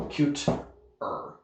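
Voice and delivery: Cute-er split as "cute" and "er"; word plus ending. Shown as a demonstration. Teacher showing the added ending, Cute-er broken in two. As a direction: say Cute-er in two separate parts, the ending set apart. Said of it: "you just put an R".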